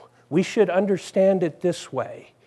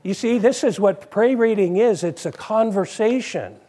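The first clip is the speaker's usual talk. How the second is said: ordinary talk, nothing marked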